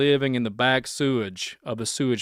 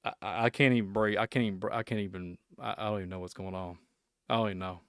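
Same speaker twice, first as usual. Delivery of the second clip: fading quieter, lost for words; exasperated mumble fading out; trailing off in disbelief; 3 semitones lower, 6 dB quieter, faster than usual. Real, not acted. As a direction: let the voice sink away, at a loss. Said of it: "I'm not losing my composure".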